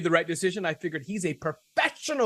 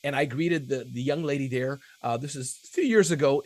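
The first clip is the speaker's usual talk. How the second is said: normal talking voice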